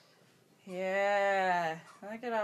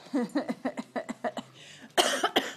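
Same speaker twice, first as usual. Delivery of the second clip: breathy laugh; 3 semitones higher than usual. Real, not acted. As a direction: laugh fondly at the dog. Laughing at the dog.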